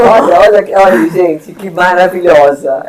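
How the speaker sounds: delighted host calling out to viewers; excited praise. Real, not acted. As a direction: rave about the guest to the audience. Host praising guest on live.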